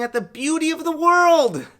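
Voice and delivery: a high, breaking laugh at the end. Real, not acted. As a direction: laugh through the words, voice cracking high. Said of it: "Well, it's true".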